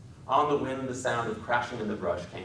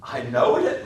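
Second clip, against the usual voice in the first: put-on louder, eager childlike cry; cried out; 4 semitones higher, 9 dB louder, slower than usual. A narrator's reading. Lenny eagerly assuring George, louder. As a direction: blurt it out with relief. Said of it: "Lenny cried".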